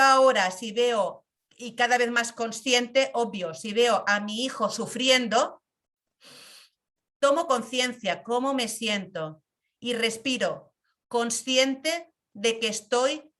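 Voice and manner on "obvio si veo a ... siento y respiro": ordinary talk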